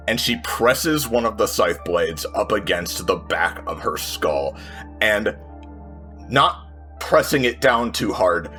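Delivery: usual speaking voice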